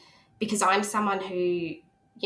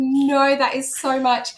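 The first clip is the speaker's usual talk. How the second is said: overwhelmed recoil; 5 semitones higher, 6 dB louder, slower than usual. Retold or imitated. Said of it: "this full physical response to it".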